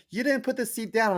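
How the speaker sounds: high-pitched mock whine; imitated complaining girls. Co-host imitating girls who fell in the toilet, whiny. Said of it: "They're like".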